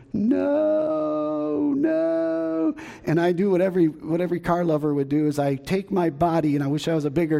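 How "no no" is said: dismayed plea. Retold or imitated. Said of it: "And I'm going".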